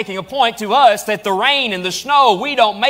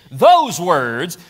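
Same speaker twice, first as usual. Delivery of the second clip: preaching at full force; 3 semitones higher, slower than usual. Acted. Pastor building to a climax for the church, fervent preaching. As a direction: build the list to a ringing climax.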